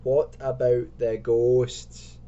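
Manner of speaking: very slow, loud, drawn-out question; spooky question. Acted. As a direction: stretch every word ominously. Asks about ghosts, spookily.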